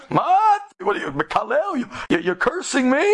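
high, indignant retort; upset. Retold or imitated. Taxi driver accusing his passenger of cursing him, upset. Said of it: "the Nahag got upset".

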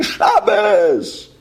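Shabbos cried out high and long, falling at the end; impassioned cry of Shabbos; soul screaming. Retold or imitated. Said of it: "My neshama was screaming".

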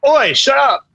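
loud, high, bad British retort; badly faked British Ron voice; terrible English accent. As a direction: bark it in a hammed-up English accent. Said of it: "with that terrible accent".

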